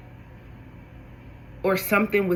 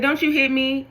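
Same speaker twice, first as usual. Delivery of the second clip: mock scolding parent; 7 semitones higher than usual. Parent scolding a hitting baby.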